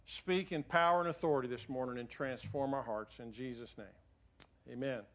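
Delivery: low, halting prayer with long pauses; public prayer. Real, not acted. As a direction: pray reverently in short phrases, pitched low. Leads the congregation in prayer.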